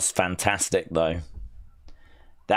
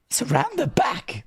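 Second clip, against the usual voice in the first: high sing-song repeat; high mock voice; 13 semitones higher than usual. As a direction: echo it in a high voice. Mocking the line.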